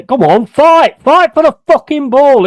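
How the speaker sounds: loud, high-pitched urging; urgent shout. Real, not acted. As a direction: yell at your team to battle. Urging his team on during play.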